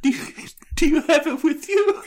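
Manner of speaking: high, giggly question; giggling. Real, not acted. Co-host teasing over a breakup note, giggling. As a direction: ask it while cracking up with laughter. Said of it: "giggling over".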